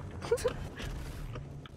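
soft, high-pitched laugh; quiet real laugh. Real, not acted. Streamer after cursing at the game, laughing softly.

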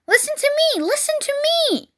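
very high scream, volume held back. A narrator's reading. Goose demanding Wilbur's attention, screamed. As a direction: shriek it high without much volume. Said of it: "screamed the goose".

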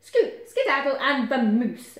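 bossy, punchy send-off; shooing. A narrator's reading. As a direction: shoo them off at full voice. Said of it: "he shooed them all over".

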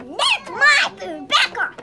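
loud slow growling claim; angry dragon roar. Acted. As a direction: roar to drive them off. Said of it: "the dragon came and he said".

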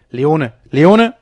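loud urgent call, rising at the end; urgent protest. A narrator's reading. Captain tries to stop his wild friend, urgent loud call. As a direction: call out the name to hold him back.

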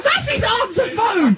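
high-pitched scandalised wail; horrified exclamation. Real, not acted. [name] horrified the remark is on the answer phone, high wail. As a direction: cry it out high, appalled and amused. Horrified after indiscreet blurt on message.

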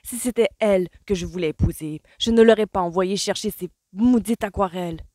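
loud, exasperated outburst; angry. A narrator's reading. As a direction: let the rage spill over. Colin angry at the mother.

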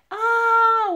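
put-on loud, high wail of a little girl; wailing howl. A narrator's reading. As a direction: burst into a piercing "aah" of crying. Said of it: "urlă prichinduța cu codițele scurte". (urlet ascuțit, plângăreț)

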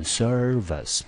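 slow "service" with stress on SER, weak -vice; first-syllable stress. Shown as a demonstration. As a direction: say "service" as SER-vəs, drawn out. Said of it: "重音节第一个音节落在第一个". (慢念 service，重音在第一音节，第二音节轻读)

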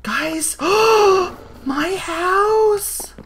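high, loud wail to viewers; dismayed cry. Real, not acted. Gamer to viewers seeing her ruined house, dismayed cry.

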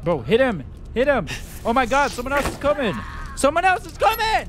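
high, loud panicked shouts; frantic excited yelling. Real, not acted. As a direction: yell it as the fight goes wrong. Frantic gamer fight panic.

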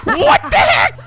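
high, amazed yell; excited shouting. Real, not acted. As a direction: shout it in gleeful disbelief. Reacts to player on fire.

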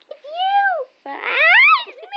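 very high squeaky voice. Real, not acted. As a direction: squeak it out playfully. Squeaky put-on voice.

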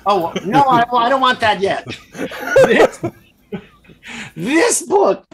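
can't hold back a laugh; real giggle breaking up the line. Real, not acted. Host laughing at the praise.

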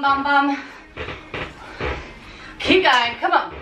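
ordinary talk

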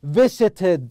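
"Visited" said loudly with a distinct final id; clear -id ending. Shown as a demonstration. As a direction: model "Visited", sounding out the last syllable as id. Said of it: "آي دي".

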